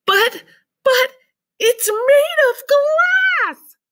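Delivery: high, loud, slow wailing cry; panicked shout. A narrator's reading. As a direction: stammer in terror. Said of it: "shouted Grandpa Joe".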